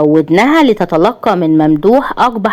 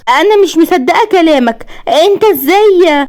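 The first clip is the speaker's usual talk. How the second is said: high-pitched incredulous protest; high, stunned indignation; outraged disbelief; 10 semitones higher than usual. A narrator's reading. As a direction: challenge him in disbelief, voice way up. Wife in disbelief at husband.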